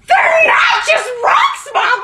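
high, slow, drawn-out shout of protest; whiny teen protest. Acted. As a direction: stretch it out like an offended teenager. Teen arguing with mom in shop.

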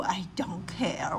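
unvoiced, dismissive whisper; whispered brush-off. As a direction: breathe it out, uncaring. Bear dismisses mouse.

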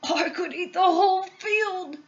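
put-on high, gleeful old man's character voice; delighted grandpa. A narrator's reading. Grandpa tasting sugar grass, grinning with delight. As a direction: grin through it as a thrilled grandpa. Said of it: "grinning with delight".

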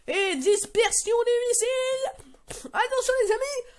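high, stretched announcer call; high-pitched, drawn-out hype; excited warning. Real, not acted. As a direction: call it out as the missiles scatter. Calls out the missiles scattering.

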